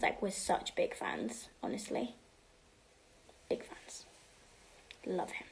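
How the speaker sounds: whispered. Real, not acted. Whispered fan gushing.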